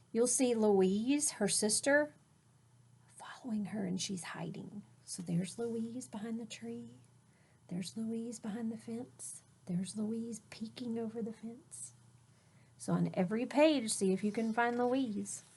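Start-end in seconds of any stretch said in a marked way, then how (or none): 3.2-11.9 whisper. A narrator's reading. Teacher whispers hiding sister.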